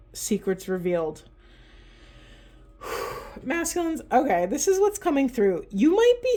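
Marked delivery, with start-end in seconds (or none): 2.8-3.4 long breathy unvoiced sigh; heavy sigh. Real, not acted. Reader pausing before the next message, soft sigh. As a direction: let out a soft, audible breath.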